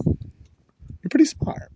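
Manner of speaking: slow airy whisper, pitched high. Retold or imitated. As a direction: breathe it out slowly as a secret. Whispered compliment in the retelling.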